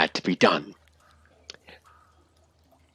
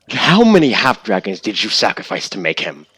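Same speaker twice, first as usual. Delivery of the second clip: ordinary talk, nothing marked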